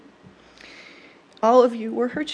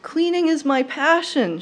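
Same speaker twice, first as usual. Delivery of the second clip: higher, slower, emphatic grandma voice; grandmother's declaration; 5 semitones higher than usual. Acted. Granddaughter quoting Gram on housework, high and emphatic. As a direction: declare it slowly as a proud grandma. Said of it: "she would say".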